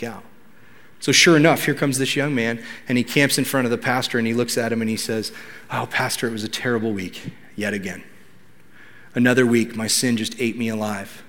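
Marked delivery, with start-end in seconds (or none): 5.7-11.2 quiet, low, defeated admission; dejected confession. Retold or imitated. Young man confessing another terrible week to his pastor, dejected. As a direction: softly own up, worn down by failure.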